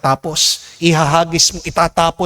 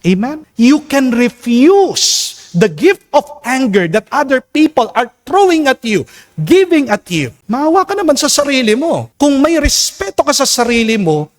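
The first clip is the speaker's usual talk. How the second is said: full-force preaching; 10 semitones higher than usual. Acted. Preacher urging listeners to refuse the gift of anger, fervent. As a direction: plead with the church to refuse anger. Preacher pleading, high and loud.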